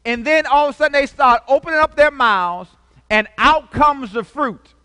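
high, loud preaching at full force; fiery preaching. Acted. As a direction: drive the sermon home to the congregation.

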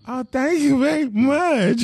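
high, slow, pleased thank-you; a woman gushing over a compliment; delighted woman. Retold or imitated. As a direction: play a flattered woman lapping it up. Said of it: "Women like".

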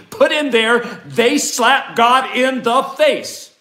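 loud, high and very slow; outraged proclamation. Acted. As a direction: declare it as the true title, loud and drawn out. Preacher outraged at the title.

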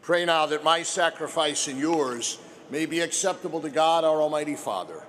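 solemn liturgical prayer voice. Acted.

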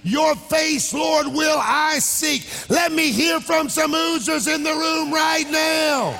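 full-force preaching. Real, not acted. Preacher urging the congregation to ooze, full-force preaching. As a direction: rouse the room to make noise.

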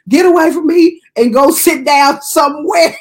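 high, shouted brush-off breaking into laughter; mock scolding. Worker tells man in wheelchair to go away, mock scolding. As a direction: holler a comic dismissal.

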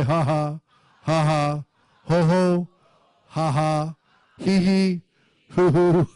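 spoken laugh syllables in short bursts; deliberate laughing. Shown as a demonstration. Preacher getting everybody to say ha ha, deliberate laughing. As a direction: lead a group in laughing on purpose. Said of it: "Everybody say".

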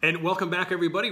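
loud, high, upbeat show-host welcome; excited welcome. Real, not acted. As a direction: bring the energy back after the break. Host returns from break.

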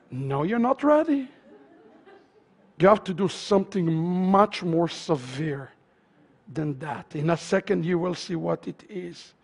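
low, soft and deflating; grave hushed warning. Real, not acted. Preacher warning congregation of something severe, grave hushed warning. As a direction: drop right down to sober them. Deflates eagerness, warns of more.

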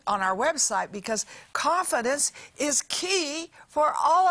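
rally the congregation with urgency; preaching at full force. Acted. Preacher urging listeners to have confidence, in a fervent preaching voice.

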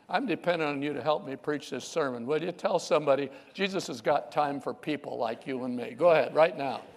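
ordinary delivery

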